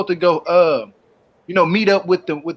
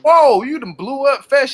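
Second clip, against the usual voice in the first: slow, louder, higher stunned reaction; shocked whoa; 6 semitones higher, 4 dB louder, much slower than usual. Retold or imitated. Reacting to Angela's outburst at the meeting, stunned and loud. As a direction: recoil at how fast she exploded. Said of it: "I said".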